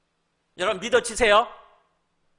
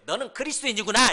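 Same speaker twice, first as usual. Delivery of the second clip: higher, louder jeer; acted name-calling; mock insult; 5 semitones higher, 5 dB louder than usual. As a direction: throw the label at them like a slur. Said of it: "그렇게 욕을 한 거예요".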